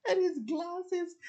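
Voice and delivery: laugh-crying rant. Real, not acted. Ranting about drunk brother's glasses.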